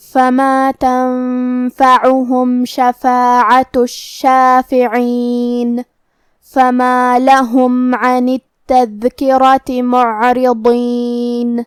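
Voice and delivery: slow, drawn-out tajweed chanting; Quranic recitation. Shown as a demonstration. Teacher reciting the verse for students, slow melodic chant. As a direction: intone each verse slowly and melodically.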